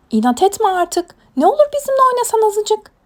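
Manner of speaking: high, loud coaxing; insistent pleading. Acted. Animals beg Mina to play, high loud pleading. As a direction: urge the stubborn one, pitch and volume up.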